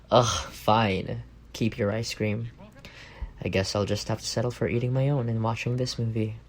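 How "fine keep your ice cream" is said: normal talking voice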